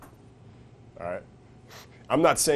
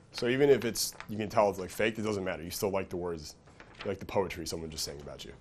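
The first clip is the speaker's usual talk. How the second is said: ordinary talk, nothing marked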